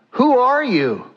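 high, startled question falling at the end; startled father. Acted. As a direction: ask it high, taken aback. Startled father questions his son.

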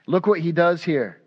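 usual speaking voice

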